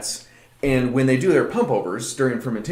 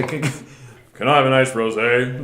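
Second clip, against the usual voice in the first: deep man voice. Retold or imitated. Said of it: "super deep man voice".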